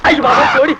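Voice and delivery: shouted outburst of dismay; alarmed cry. Acted. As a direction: cry out in sudden shock. Villager finds his hen killed.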